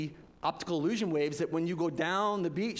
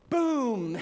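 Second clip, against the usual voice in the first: loud crash effect; 7 semitones higher, 6 dB louder than usual. Retold or imitated. Wave crash in story.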